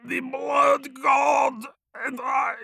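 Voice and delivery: slow, high, strained dying shout. Acted. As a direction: force out a last defiant cry. Dying champion's last defiant cry.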